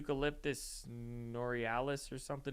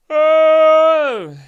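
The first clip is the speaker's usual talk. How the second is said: sudden loud high yelp; a startled, loud shout; surprised oh; 13 semitones higher, 24 dB louder than usual. Real, not acted. Reading a bucket list, he yelps in sudden surprise.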